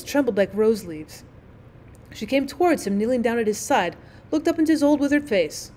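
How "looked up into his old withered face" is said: ordinary delivery, nothing marked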